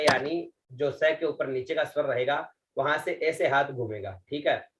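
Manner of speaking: ordinary talk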